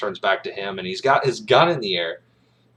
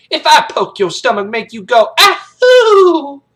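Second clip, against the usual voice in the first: loud taunt rising to a squeal; high cartoon doughboy hoot at the end; mocking the cadet; 14 dB louder, slower than usual. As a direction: tease at drill-sergeant volume. Sergeant mocks chubby cadet.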